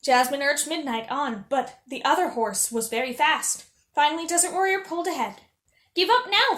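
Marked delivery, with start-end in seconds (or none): 5.9-6.6 high, loud rival's taunt; shouted taunt. Acted. Faiz shouting at Jasmine as he pulls ahead, high and loud. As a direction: yell it down at her from ahead. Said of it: "Faiz shouted".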